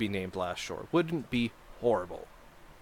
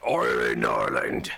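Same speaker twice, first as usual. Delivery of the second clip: much lower, mock booming island chant; silly deep voice; 13 semitones lower, 4 dB louder, slower than usual. Streamer mocking the placeholder island name in a deep goofy voice. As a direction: boom the name like a goofy giant.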